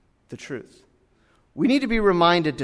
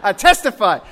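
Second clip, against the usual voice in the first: loud preaching declaration; imitated worked-up preacher; fired-up preacher; 3 semitones higher, 7 dB louder than usual. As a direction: testify with mock pulpit fervor. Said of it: "getting my preacher juices flowing".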